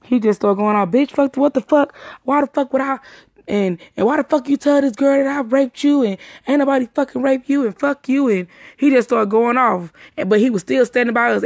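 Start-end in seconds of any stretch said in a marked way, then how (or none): 0.9-8.3 mock cursing tirade, pitched up; retold flipping out. Retelling the man cussing them out, flipping out. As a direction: imitate his furious going-off, voice raised in pitch. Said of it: "He flips the fuck out".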